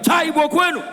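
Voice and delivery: loud, high rally cry to the crowd; rallying shout. Acted. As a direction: call the crowd to answer. Rallying Igbo crowd chant.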